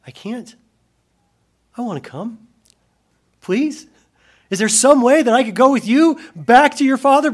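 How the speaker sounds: high, rising plea growing loud; pleading. Pretend. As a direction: beg to come along. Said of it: "respond and say".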